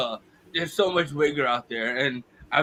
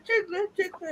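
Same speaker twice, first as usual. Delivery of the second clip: put-on high-pitched child gum seller; little girl vendor; 4 dB quieter than usual. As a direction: call it out like a small girl selling gum. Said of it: "I saw this little girl offering".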